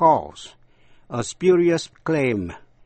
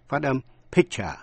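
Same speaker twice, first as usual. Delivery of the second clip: ordinary talk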